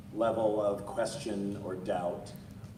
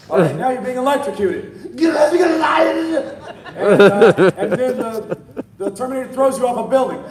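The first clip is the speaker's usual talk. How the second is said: laughing; 12 semitones higher, 15 dB louder than usual. Retold or imitated.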